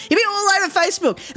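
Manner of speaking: high mocking repeat. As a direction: jump up high on Facebook.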